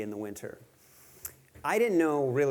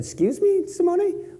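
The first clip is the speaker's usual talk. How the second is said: imitating Simone; 7 dB louder than usual. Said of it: "I said".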